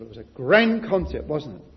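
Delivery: ordinary talk, nothing marked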